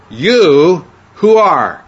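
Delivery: slow and loud, with "You" and "who" hit hard; emphatic YOU. Shown as a demonstration. Teacher shows the emphatic Greek word order, slow and loud. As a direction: drag out "You who are" with force. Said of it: "very emphatic".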